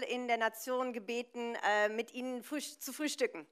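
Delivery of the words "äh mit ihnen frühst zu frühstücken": ordinary talk, nothing marked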